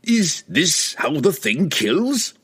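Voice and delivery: high, drawn-out incredulous question; indignant disbelief. A narrator's reading. Pook rounding on wizard Laval, indignant disbelief. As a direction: demand it in disbelief.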